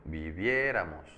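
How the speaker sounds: slow Viviéramos with a drawn-out stressed É. Shown as a demonstration. Teacher showing where the accent falls, stress on É in Viviéramos. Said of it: "tendría acento en la E".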